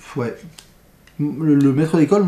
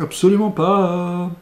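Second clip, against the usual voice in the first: slow, drawn-out absolument pas; emphatic refusal; 3 semitones higher, slower than usual. Real, not acted. Reviewer firmly telling viewers he won't watch the film, slowly. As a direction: stretch absolument for a flat refusal. Firm refusal after repeating.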